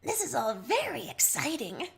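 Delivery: lightly voiced, cheerful Terezi. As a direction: sound delighted to be here. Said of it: "Terezi says cheerfully".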